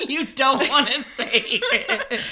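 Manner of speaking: high, loud, slow teasing laugh; laughing tease. Real, not acted. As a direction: draw it out while cracking up. Laughing at friend's reluctance.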